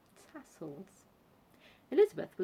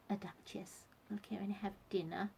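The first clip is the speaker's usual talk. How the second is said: whispering; 7 dB quieter than usual. A narrator's reading. Aside whispered to sister.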